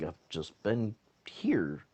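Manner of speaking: hushed, breathless whisper; a slow, secret whisper. Retold or imitated. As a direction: whisper it so nobody finds you. Hiding out, caught by a searcher.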